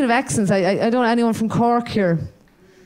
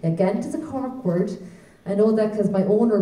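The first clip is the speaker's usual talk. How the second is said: ordinary delivery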